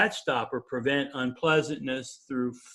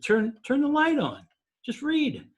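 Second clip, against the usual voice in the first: insisting; 10 semitones higher than usual. Retold or imitated. Insisting wife read with light.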